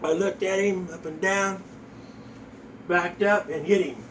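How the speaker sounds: ordinary talk, nothing marked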